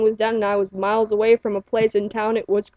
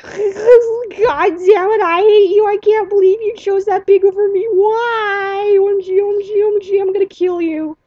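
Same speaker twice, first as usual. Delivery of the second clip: shrill, much higher, louder tearful screech; screeching rage; 11 semitones higher, 5 dB louder than usual. Retold or imitated. A child shrieking at the monster, high and tearful. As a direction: screech it like a crying, furious kid. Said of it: "I was like".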